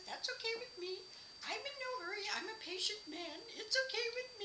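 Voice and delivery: high-pitched mock singsong; sing-songy voice. Man letting her go ahead in line, sing-songy. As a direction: lilt it high, imitating him. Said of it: "he had this sing-songy voice".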